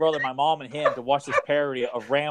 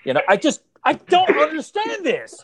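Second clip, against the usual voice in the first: exasperated outburst; 12 semitones higher, 4 dB louder than usual. Acted. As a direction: act out a dad completely losing patience. Acts out dad's outburst at nudity.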